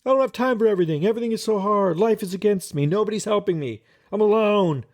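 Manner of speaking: hammed-up overwhelmed complaining; freaking out. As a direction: spill it out like someone overwhelmed. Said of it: "freak out".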